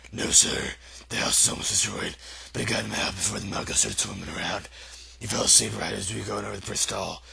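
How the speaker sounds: low, deep voice of a gentle giant; giant's voice. Acted. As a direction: rumble it out like a big shy giant.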